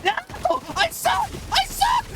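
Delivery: screaming very high and loud; real anguished shriek; real frustrated scream. Real, not acted. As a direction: shriek it at yourself after losing. Losing the game, screams.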